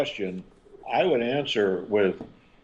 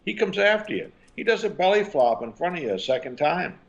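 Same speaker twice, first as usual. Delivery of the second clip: animated telling; 9 semitones higher than usual. Shown as a demonstration. Parent describing the toddler's tantrum, animated and high. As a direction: voice climbing high as the kid chases.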